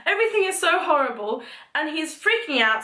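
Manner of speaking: normal talking voice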